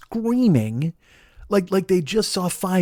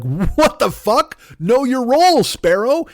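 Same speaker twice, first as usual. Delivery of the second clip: mock outrage; 7 semitones higher, 4 dB louder, slower than usual. Homeowner yelling at sparrow.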